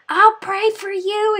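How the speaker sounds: high, slow sugary put-on voice; snooty church lady. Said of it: "the woman was like".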